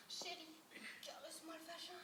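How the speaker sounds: soft, intimate plea to a lover; mock bedroom murmur. Woman to her lover during lovemaking, mock-tender murmur. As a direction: murmur it like pillow talk, sending it up. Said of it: "pendant que vous faites l'amour".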